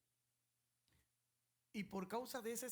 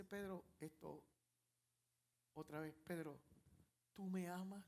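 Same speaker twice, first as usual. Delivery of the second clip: soft, low and tender; the Lord asking Peter a second time, soft; gentle question; 4 semitones lower, 5 dB quieter than usual. Acted. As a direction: ask patiently once more. The Lord asks again.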